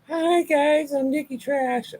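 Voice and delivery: mock squeaky-cute introduction, very high; sing-song high mocking voice; Nikki Trash. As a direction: parody a perky intro in a high falsetto.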